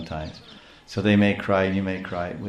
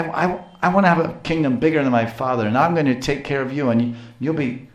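put-on higher-pitched little boy, worked up; indignant protest; 6 semitones higher than usual. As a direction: protest hotly on your mother's behalf, play-acted. Acted indignant boy protesting to mother.

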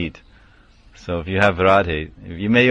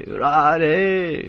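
devotional call; 8 semitones higher than usual. Retold or imitated. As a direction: imitate a long rising call of the name. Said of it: "we say".

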